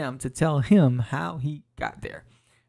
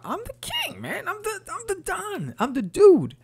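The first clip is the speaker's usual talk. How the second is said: much higher, puffed-up voice of a self-crowned king; cocky king. Acted. Amnesiac king boasting to himself about his rank, cocky high voice. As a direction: crow it like a kid who just found out he rules.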